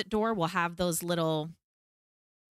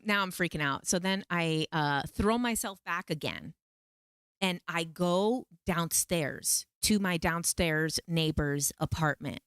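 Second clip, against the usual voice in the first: normal talking voice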